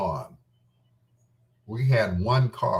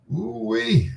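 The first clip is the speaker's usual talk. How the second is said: excited whoop; 13 semitones higher than usual. Real, not acted. Reacting to a hot memory.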